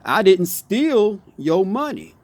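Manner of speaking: slow, steal drawn out and punched; slow stress on steal. Shown as a demonstration. Repeating the demo for viewers, slow stress on steal. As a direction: take it slowly, hanging on steal. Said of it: "it means something totally different".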